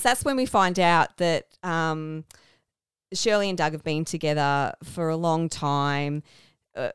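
normal talking voice

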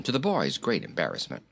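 ordinary delivery, nothing marked

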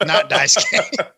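drawn-out words through laughter; cracking up while getting the words out; slow, amused, laughing aside. Real, not acted. Cracking up at own joke.

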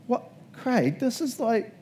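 high, slow, baffled and disgusted; disgusted disbelief. Retold or imitated. Jordan opening a gift he hates, baffled disgust. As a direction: let the words hang in stunned distaste. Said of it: "just be like".

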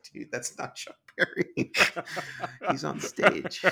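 can barely get it out for laughing; laughing correction. Retold or imitated. Correcting colleague, cracking up.

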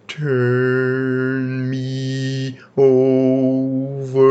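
very slow, high moaning ghost voice, a bit stronger. Acted. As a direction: call slowly from the grave. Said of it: "The voice was louder".

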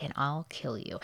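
soft, level voice for a quoted death threat; eerily calm. Retold or imitated. Betty's voicemail threatening Daniel, softly and eerily calm. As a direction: imitate her calm, quiet threat. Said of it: "And she says it so calm".

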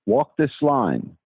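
measured poem reading with long pauses between lines; solemnly paced amateur sonnet reading; poem recitation. Acted. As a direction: recite your own verse line by line, letting each phrase land. Caller reads own poem aloud.

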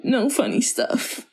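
imitating a teary plea, pitch a bit up; half-hearted mock crying on "No funny stuff"; mock tearful. Friend retells the heroine answering through tears, mock tearful. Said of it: "through her tears is like".

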